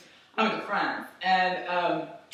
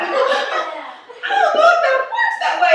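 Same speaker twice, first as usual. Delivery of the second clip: very high, loud laughing doubt; skeptical; 6 dB louder, faster than usual. Retold or imitated. Wife doubting husband's sheep plan, high skeptical squeal. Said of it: "I was like".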